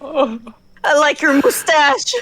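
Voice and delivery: teasing. Acted. Player teasing the French dragon.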